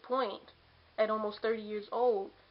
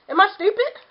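a much higher, louder, incredulous "Am I stupid?"; sarcastic retort; 11 semitones higher, 13 dB louder than usual. Retold or imitated. Answering someone who asks if she called ahead, loud sarcastic disbelief. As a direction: throw it back, mocking the question.

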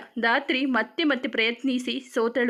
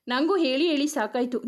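plain reading voice

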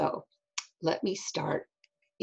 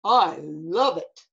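storybook bear; 2 semitones lower than usual. Acted. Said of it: "said the bear".

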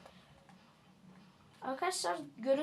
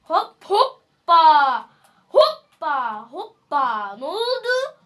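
loud, drawn-out whoops rising into high mocking questions; gloating taunt; 4 semitones higher, 14 dB louder, much slower than usual. Real, not acted. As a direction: jeer at a beaten rival. Mocking a game opponent.

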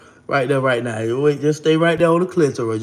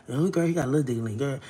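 gossiping whisper; 4 semitones lower, 8 dB quieter, faster than usual. Retold or imitated. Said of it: "whispering and".

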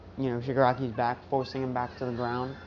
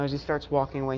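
ordinary delivery

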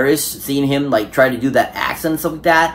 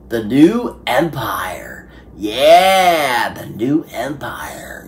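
slow, hushed whisper of the subtitle; dramatic whisper; much slower than usual. Pretend. Vlogger hushing the film subtitle in a slow, dramatic whisper. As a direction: breathe the title like a secret.

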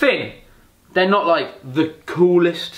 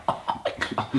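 whispered, awed; softer whispered awe; 10 dB quieter, faster than usual. Retold or imitated. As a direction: breathe it out in an unvoiced gasp of dread. Dropping in on a ramp.

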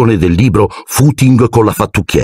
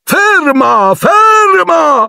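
panicked shout; much slower than usual. A narrator's reading. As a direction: yell for it to stop. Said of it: "gridava Lockhart". (grida acute e in panico)

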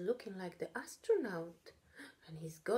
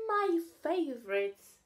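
delighted; 8 semitones higher, 5 dB louder than usual. Acted. Charlie happy.

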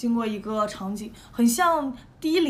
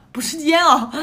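exclaiming; 3 semitones higher, 7 dB louder than usual. Real, not acted. Punchline, laughing at the letdown.

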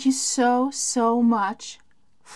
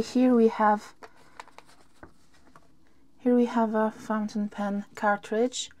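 ordinary talk, nothing marked

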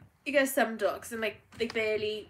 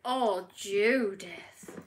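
ordinary talk, nothing marked

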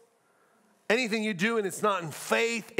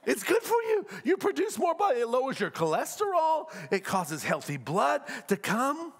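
ordinary talk, nothing marked

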